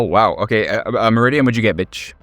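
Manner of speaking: ordinary delivery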